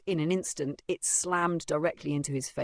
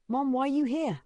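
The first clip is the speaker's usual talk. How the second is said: higher, dismayed question; flustered; 10 semitones higher than usual. A narrator's reading. Boy sees his mother at school, flustered. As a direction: ask it in flustered surprise. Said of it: "he grew flustered, stammering".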